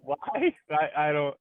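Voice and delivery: high laughing disbelief. Real, not acted. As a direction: laugh it out in shock. Laughing at absurd suggestion.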